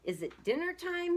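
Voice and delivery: high-pitched calling bellow; high, sing-out call; bellowed question. A narrator's reading. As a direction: holler it high from up the hillside. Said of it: "he bellowed".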